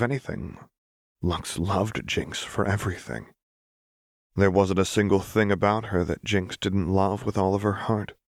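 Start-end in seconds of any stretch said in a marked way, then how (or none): none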